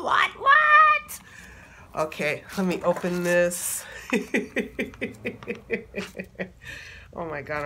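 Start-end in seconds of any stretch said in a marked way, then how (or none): 0.0-1.2 high, loud squeal of disbelief; real squeal of surprise; shocked disbelief. Real, not acted. Unboxing the printed deck. 3.9-7.1 soft unvoiced giggle of joy; real giddy breathy laugh; breathless excited laugh. Real, not acted. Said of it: "I'm so excited".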